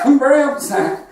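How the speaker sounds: hammed-up upset old man, pitched high; put-on high-pitched upset voice; mock crying. Old man insisting to the jogger, mock crying.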